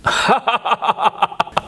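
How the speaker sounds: a short airy laugh; breathy laugh. Real, not acted. Laugh at irony.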